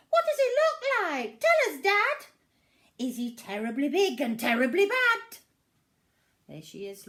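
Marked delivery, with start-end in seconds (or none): 0.1-5.4 put-on high, fast, loud child creature voice; an eager little monster pestering her dad; high squeaky excited child creature; little Gruffalo's child.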